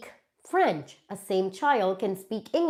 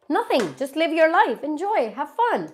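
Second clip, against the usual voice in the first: higher and louder, breezy; carefree burst; 6 semitones higher, 3 dB louder than usual. Real, not acted. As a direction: toss off the advice cheerfully. Carefree advice to viewer.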